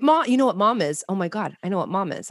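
ordinary talk

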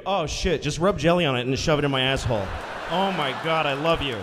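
loud, gushing mock ecstasy; hammed-up loud delight; thrilled wife. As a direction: blurt it out, overwhelmed with gratitude. Acts the suddenly thrilled wife.

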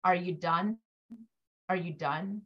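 low, soft and flat-out checked out; mock zoned-out mumble. Zoned-out kid to talking parent, soft low mumble. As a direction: murmur it like a kid who stopped listening. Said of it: "They're just like".

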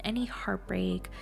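ordinary delivery

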